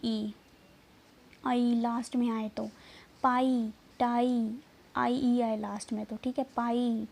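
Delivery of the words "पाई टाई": पाई, टाई drawn out with an "eye" ending; long i demo. Shown as a demonstration. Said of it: "आई की sound आएगी". (पाई, टाई में आई की ध्वनि खींचकर)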